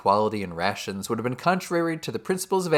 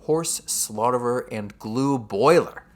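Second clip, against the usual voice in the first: slow, louder, mildly acted horror; terror; 5 dB louder, slower than usual. Benjamin reads the side of the van to the animals, acted terror. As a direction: read each word out slowly with dawning dread. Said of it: "tells them with terror".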